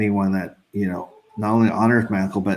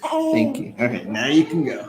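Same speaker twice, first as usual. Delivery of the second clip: very high cooing thanks; 6 semitones higher than usual. Real, not acted. Thanking baby after kiss.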